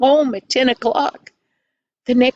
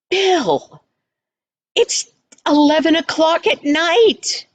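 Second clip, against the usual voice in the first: high-pitched mock scolding; exasperated mother; 7 semitones higher than usual. Mother to uncle about the late hour, exasperated. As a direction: raise the pitch in acted disbelief.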